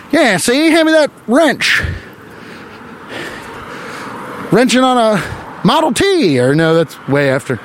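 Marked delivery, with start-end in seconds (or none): 0.1-6.4 high, hammed-up old-timey tough guy; 1920s factory worker. Comic imagining a 1920s factory worker, mock gangster voice. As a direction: ham up a "see"-saying 1920s worker. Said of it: "talked like that".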